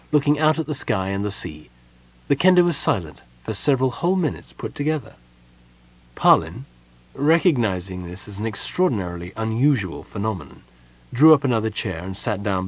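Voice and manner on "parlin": ordinary delivery, nothing marked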